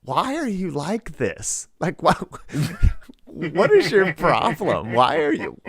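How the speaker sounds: high, slow disbelieving laugh-talk; exasperated laughing. Retold or imitated. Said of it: "we're like".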